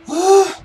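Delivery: sharp, high gasp; stressed gasp. Real, not acted. Player stressed after quitting a fight, gasping. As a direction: let out a sudden gasp of breath.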